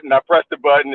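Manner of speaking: ordinary delivery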